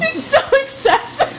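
high-pitched, laugh-choked complaint; giggling exasperation. Real, not acted. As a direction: squeal it through laughter. Still cracking up.

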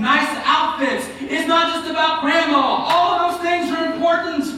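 high, insistent pulpit cadence; sustained, ringing exhortation; forceful preaching. Real, not acted. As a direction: drive each point home like a preacher.

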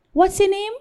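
light, high-pitched asking; simple question. Retold or imitated. Client asking the salon boss a simple question, high and casual. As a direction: ask it casually, pitched well up.

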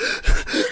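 breathy voiceless gasp; mock gasp. Choking date gasping in front of the man, a breathy gasp. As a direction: suck in air like you're choking. Wheezing reaction acted out.

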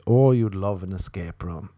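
usual speaking voice

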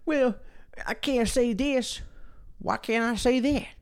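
high nasal-free mock complaint; mocking whiner. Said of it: "they're just like".

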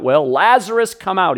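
a commanding call into the tomb; loud shout. Retold or imitated. Jesus calls dead Lazarus from the tomb, loud commanding shout. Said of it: "a loud shout".

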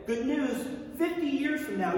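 high, upbeat announcer-like delivery; good-news announcement. Acted. Someone announcing a far-off lottery win, good-news announcement. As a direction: break the news, pitched well up.

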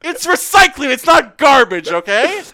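high, loud indignant rant; worked-up, emphatic yelling; exasperated shouting. Retold or imitated. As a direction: yell it like scolding a roommate who never listens. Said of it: "you shouted at him".

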